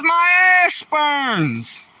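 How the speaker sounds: put-on high, slow wailing Irish lady accent. Lady on a bus spilling her aspirin, high slow Irish accent. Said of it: "a lady with an Irish accent".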